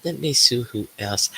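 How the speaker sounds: ordinary talk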